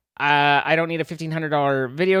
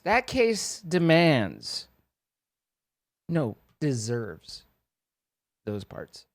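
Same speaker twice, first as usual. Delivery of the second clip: slow, softer, reverent; reverent hush; 4 semitones lower, 4 dB quieter, much slower than usual. Acted. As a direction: speak of it like something sacred. Reverent praise of the case.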